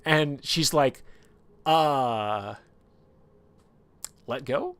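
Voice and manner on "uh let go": hesitant, drawn-out awkward request; awkward discomfort. Retold or imitated. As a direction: retell an awkward, higher-pitched question. Woken by hand on her leg.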